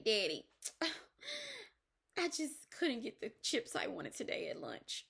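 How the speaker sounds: put-on a small child sighing and whining to daddy, trailing off soft; whiny child. Child crying to daddy about the lunch chips, whiny sigh. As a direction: play a kid upset over missing chips. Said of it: "they go and cry with their daddy and they say".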